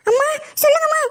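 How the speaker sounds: high, sing-song answer to mom; sweet reply to mother. Acted. Daughter answering her mother's call, high and sweet.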